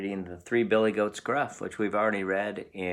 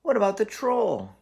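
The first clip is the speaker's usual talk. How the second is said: higher, louder worried goat; frightened cry; 13 semitones higher, 6 dB louder than usual. Acted. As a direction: blurt it out in alarm as the nervous goat. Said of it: "cried Middle Billy Goat Gruff".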